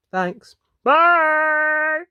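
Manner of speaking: bright, loud, high sung-out bye; cheery goodbye. Real, not acted. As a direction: call out a happy farewell. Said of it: "signing off the video".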